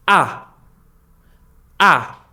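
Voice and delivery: Ah starting high and falling steeply; short, sharp Ah dropping from the top; sharp fourth tone. Shown as a demonstration. Teacher showing learners the fourth tone, Ah sharply falling. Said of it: "is very sharp".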